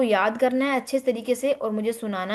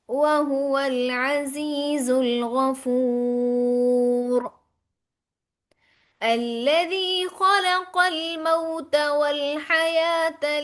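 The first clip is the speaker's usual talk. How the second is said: slow melodic tajweed recitation; Quran recitation; 3 semitones higher, much slower than usual. Shown as a demonstration. Teacher reciting verses to her students, slow melodic tajweed. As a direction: chant each verse slowly with tajweed.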